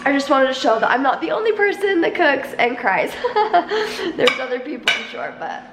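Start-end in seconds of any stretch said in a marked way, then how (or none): 3.2-4.1 teary laugh. Real, not acted. Laughs through tears.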